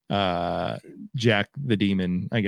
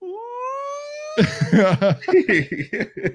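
small, very high squeal; stifled little shriek with laughter; held-back baby scream. Retold or imitated. Theater crowd reacting to something in the attic, held-back scream. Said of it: "that little baby scream that you're trying to hold back".

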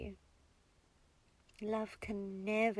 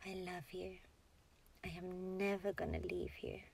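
very soft, near-whispered self-soothing; inner voice; 3 semitones lower, 8 dB quieter than usual. Shown as a demonstration. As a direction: murmur it softly as if only to yourself. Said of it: "Say it in your inner voice".

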